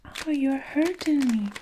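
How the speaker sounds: high, pained protest; pained cry. A narrator's reading. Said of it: "Eric cried".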